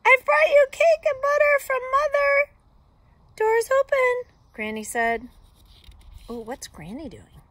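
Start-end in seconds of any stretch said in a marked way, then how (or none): 0.1-2.5 very high, loud squeaky voice; squeak. A narrator's reading. Wolf posing as granddaughter bringing cake, loud high squeak. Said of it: "I squeaked". 3.4-4.3 very high, loud granny voice; Granny calling out. A narrator's reading. Granny calling the visitor in, high loud call.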